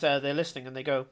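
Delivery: ordinary talk, nothing marked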